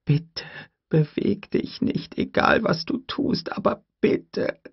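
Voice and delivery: high, unvoiced desperate whisper; pleading whisper. A narrator's reading. Tim begging Lex to stay still, whispered. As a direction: beg in a frantic whisper. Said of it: "dachte Tim".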